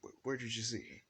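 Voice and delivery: high, loud alien demand; an engineer barking a question; urgent engineer voice. Acted. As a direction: press him for the answer. Engineer asks Deku urgently.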